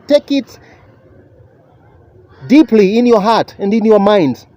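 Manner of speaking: ordinary talk, nothing marked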